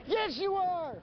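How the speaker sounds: shrieky, very high retort; hammed-up parent at the end of their rope; exasperated parent. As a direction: top the kid with a high-pitched comeback. Parent and child quarrel escalates.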